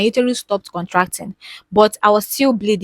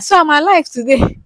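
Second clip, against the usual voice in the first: relieved excitement; 8 semitones higher, 7 dB louder than usual. Retold or imitated. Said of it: "I tapped my husband".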